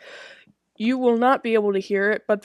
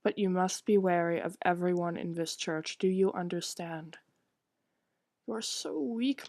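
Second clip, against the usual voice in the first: lightly voiced stern mother, cold and reproachful; 9 dB quieter than usual. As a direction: scold the girl gently but coldly, barely above speaking voice.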